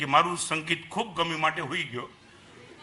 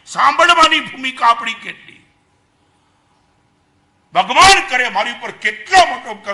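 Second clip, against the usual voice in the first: fiery preaching; 6 dB louder than usual. Acted. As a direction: preach it out to the whole hall. Preaching at full force.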